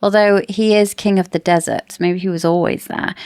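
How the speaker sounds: normal talking voice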